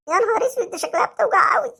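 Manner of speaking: ordinary delivery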